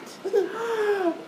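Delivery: sharp inhale before sneeze; sneeze build-up gasp. Acted. Gerald gasps before sneeze.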